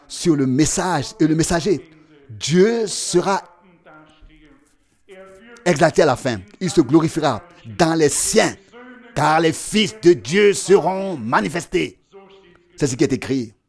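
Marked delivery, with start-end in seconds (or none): 0.1-12.0 raised, emphatic sermon voice; impassioned preaching. Acted. Preacher proclaiming to the congregation that God will be exalted, impassioned. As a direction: preach it with mounting conviction.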